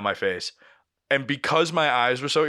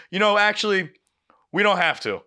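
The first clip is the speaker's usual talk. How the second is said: sheepish backing down; 8 semitones higher, 4 dB louder than usual. Retold or imitated. Lady backs down, voiced.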